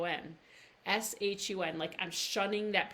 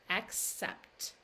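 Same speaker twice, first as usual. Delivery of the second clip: ordinary talk, nothing marked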